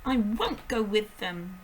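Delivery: put-on loud, slow defiant girl; shouting. A narrator's reading. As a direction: refuse with all her force. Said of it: "Matilda shouted suddenly".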